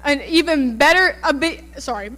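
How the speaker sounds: usual speaking voice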